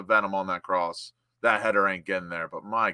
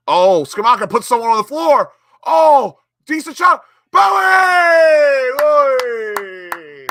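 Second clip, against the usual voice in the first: much higher and louder, yelling the scorer's name; goal celebration shout; 14 semitones higher, 12 dB louder, slower than usual. Real, not acted. Fan watching Bowen score for West Ham, yelling in celebration. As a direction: erupt as the ball goes in.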